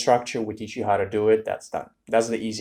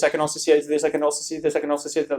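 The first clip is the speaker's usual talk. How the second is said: higher, fast rattled-off template answer; memorized nonstop answer; 5 semitones higher, much faster than usual. Shown as a demonstration. Tutor imitating a student reciting a memorized template, breathless rush. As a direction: race through the memorized script without breathing. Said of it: "but you're doing this".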